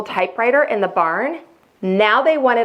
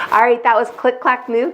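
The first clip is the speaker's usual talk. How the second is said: ordinary delivery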